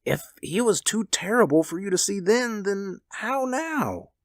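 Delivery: shaking voice. A narrator's reading. Old man warning wife of mangled son, voice shaking. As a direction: falter and break off in dread. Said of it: "and his voice shook".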